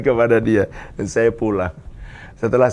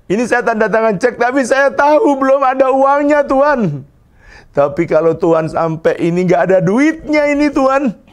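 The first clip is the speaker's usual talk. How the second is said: high, louder pleading to God; desperate prayer; 12 semitones higher, 4 dB louder than usual. Retold or imitated. Pastor signing an empty cheque, begging God in a high voice. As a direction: beg God urgently, half laughing at yourself.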